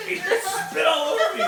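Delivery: laughing. Real, not acted. Friend spat on him.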